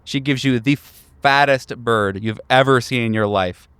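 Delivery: normal talking voice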